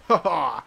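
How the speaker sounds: loud "ha ha" cackle; gloating laugh. Pretend. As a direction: let out a loud, triumphant ha-ha. Gloating after a hit in game.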